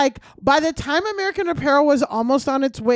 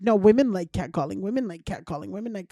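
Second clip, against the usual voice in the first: mimicking brother; 5 semitones lower, 3 dB quieter than usual. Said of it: "he's like".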